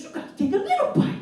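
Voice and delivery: fast, high fatherly jab; dad's catchphrase, high and fast; quick high dad voice. Retold or imitated. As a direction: rattle it off like the know-it-all dad. Father's punchline advice.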